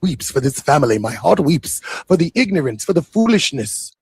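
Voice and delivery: a light put-on Nigerian accent, mock-mournful; mock Nigerian preacher lamenting. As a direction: lament the family's foolishness in a hammed-up Nigerian accent. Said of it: "That's the best accent I could do".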